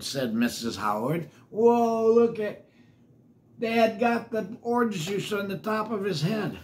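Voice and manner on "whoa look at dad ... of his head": put-on excited child voice. Child character exclaims.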